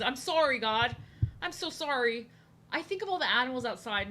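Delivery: ordinary talk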